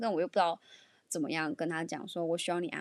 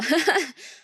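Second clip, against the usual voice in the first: a high, loud burst of laughter; 9 semitones higher, 10 dB louder than usual. Real, not acted. Advising boyfriends to stop reasoning, bursting into high loud laughter. Laughing at her own advice.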